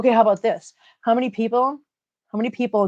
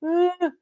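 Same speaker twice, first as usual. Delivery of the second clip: mock groan; 9 semitones higher than usual. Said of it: "I'm not sitting here going".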